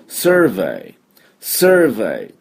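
survey said as one smooth word with a rounded sir sound and no katakana vowels; survey in native English, not サーベイ; model pronunciation of survey. Shown as a demonstration. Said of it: "この音になりません". (surveyを英語らしく「サー」を丸めて発音)

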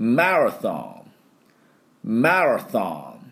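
"マラソン" very slow, stressed ma, th sound; model for repeating. Shown as a demonstration. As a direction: stretch "マラソン" out for learners to copy. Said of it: "私の後について発音いきましょう".

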